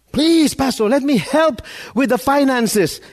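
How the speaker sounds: high, insistent mock begging; pleading. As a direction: implore the pastor with playful urgency. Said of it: "imagine somebody pleading".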